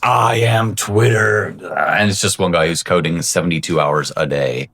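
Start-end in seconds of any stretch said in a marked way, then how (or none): 0.0-1.5 slow, mock-grand AI voice; lightly voiced AI announcing itself; mock robotic AI. AI that replaced everyone, declaring itself, mock-grand. Voicing the one-man AI Twitter.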